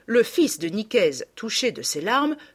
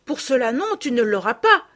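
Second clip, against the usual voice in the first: put-on raised, higher exclaiming girl; louder, higher protest; 5 semitones higher, 5 dB louder than usual. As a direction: cry out to stop her. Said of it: "s'écria Madeleine".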